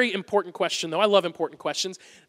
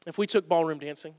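dreamy and romantic; 5 semitones lower, 6 dB quieter than usual. Retold or imitated. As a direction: imitate a wife dreaming of romance, gently. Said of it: "Megan's mentality was".

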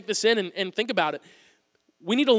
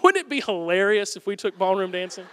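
goofy prankster; 2 semitones lower than usual. Retold or imitated. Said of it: "my mentality was".

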